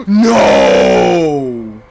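loud despairing gasp and cry; real dismay as he loses; gasp then cry of no. Real, not acted. Dying in game.

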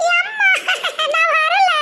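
very high giggly whine; possessed laughing. Acted. Daughter refusing to go with mother, laughing. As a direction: refuse while cackling. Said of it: "பேயெல்லாம் சிரிக்குது இப்படி".

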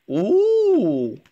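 thrilled little ooh; excited ooh. Real, not acted. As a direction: react with delight to the card. Excited reaction to a card pull.

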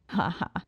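dry mocking ha ha; short contemptuous chuckle; scornful little laugh. A narrator's reading. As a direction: laugh off the legend. Laughs at urban legend.

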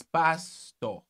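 slowly stretched PASTOR, "paas-TAW"; emphatic pronunciation. Shown as a demonstration. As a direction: draw out pastor in two parts, no final r. Insisting on correct pronunciation.

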